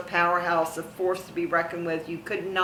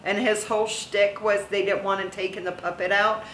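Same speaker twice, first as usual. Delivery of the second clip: ordinary talk, nothing marked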